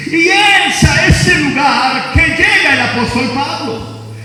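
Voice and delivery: voz de predicador, más aguda y enérgica; predicando con fuerza. Acted. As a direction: proclama a la congregación con fervor. Pastor preaching about Pablo. (predicación apasionada)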